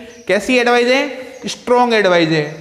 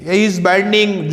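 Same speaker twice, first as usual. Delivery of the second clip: slow dictation; much slower than usual. Shown as a demonstration. Teacher reads example sentence slowly.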